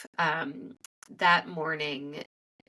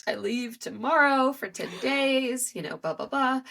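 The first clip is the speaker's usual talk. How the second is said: retold tearful blurt; 6 semitones higher than usual. Telling her son's teacher she leaves tomorrow, tearful and high. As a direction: replay the tearful outburst, a little high. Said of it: "burst into tears".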